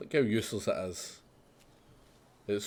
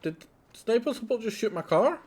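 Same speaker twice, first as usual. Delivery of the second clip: surprised; 13 semitones higher than usual. Real, not acted. His own support shoots his car.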